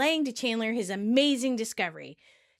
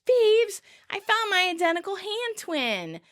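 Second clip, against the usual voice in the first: excited shout; 10 semitones higher than usual. Retold or imitated. Joey greeting Phoebe, thrilled shout. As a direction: shout the news across the room. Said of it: "and Joey shouts".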